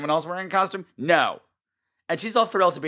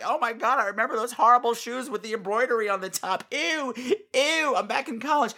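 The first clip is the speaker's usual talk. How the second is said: higher, disgusted "Ew, ew"; grossed-out nostalgia; 7 semitones higher than usual. Retold or imitated. Recalling awful nineties shoes, grossed-out. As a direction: recoil at the memory. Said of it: "I'm like".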